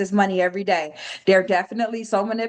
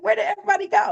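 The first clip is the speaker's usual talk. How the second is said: much higher, panicked question; bewildered alarm; 13 semitones higher, much faster than usual. Retold or imitated. Trader retelling missing friends, high bewildered panic. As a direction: look around lost and alarmed. Retold freak-out.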